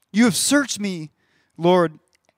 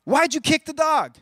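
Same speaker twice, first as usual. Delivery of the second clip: put-on higher, louder questioning of a toddler; scolding parent; 8 semitones higher, 4 dB louder than usual. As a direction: call out the child for what you saw. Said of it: "You're like".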